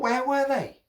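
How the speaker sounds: high, baffled question; baffled hatter. A narrator's reading. Hatter searching for his missing hats, baffled. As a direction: ask it high, at a loss.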